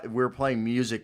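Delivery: ordinary delivery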